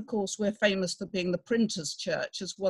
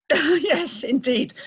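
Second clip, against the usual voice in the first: real laugh into a high, loud reply; laughing agreement; 7 semitones higher, 6 dB louder, much slower than usual. Real, not acted. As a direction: agree through a laugh, pitch lifted. Amused reply to praise of study.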